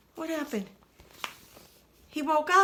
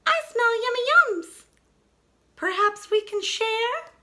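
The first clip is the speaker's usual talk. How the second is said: small animal voice; 11 semitones higher, 4 dB louder than usual. Acted. Animal guest at bear's den.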